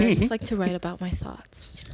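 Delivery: whispered aside; whispering. Real, not acted. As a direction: breathe it, no voice. Unvoiced aside.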